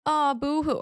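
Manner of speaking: imitating a friend's self-pitying sobs; mock crying victim. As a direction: play the self-pitying bestie, hammed up. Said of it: "She's gonna start crying like".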